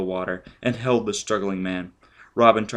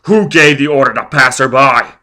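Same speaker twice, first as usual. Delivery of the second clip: very loud, higher shouted question; furious demand; 5 semitones higher, 14 dB louder than usual. Acted. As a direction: hammer the question at him. Foyle interrogating, furious.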